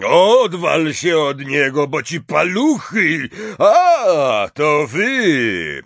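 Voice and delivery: loud, slow, high-pitched holler; big gruff giant calling out; booming character shout. A narrator's reading. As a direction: call off the dog at full voice. Hagrid calling off his dog.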